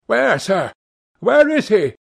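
high, urgent shouted questions; shouting. A narrator's reading. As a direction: call out urgently, pitched high. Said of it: "he shouted".

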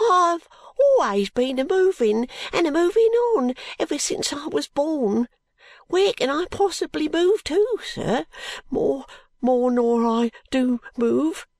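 high, slow tearful plea; a street boy pleading through tears; crying boy. A narrator's reading. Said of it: "cries the boy".

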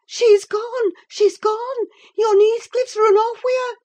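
put-on high, loud panicked servant girl; gasped alarm. A narrator's reading. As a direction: blurt the dreadful news in a high voice. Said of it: "gasped the girl".

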